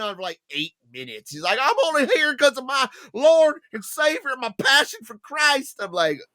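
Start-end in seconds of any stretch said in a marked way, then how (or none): 1.6-5.8 high, louder put-on voice; mock preachy singer. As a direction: testify like an earnest star. Imitating [name] again.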